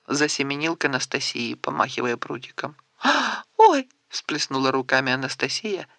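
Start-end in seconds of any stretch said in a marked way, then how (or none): 3.0-3.9 high, squealing pretend gasp; mock fright. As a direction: playfully fake being scared. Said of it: "изображая испуг". (шутливый испуг)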